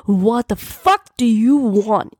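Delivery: acted thunderous demand; thundering. A narrator's reading. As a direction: bellow it slowly at an intruder. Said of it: "he thundered at whoever".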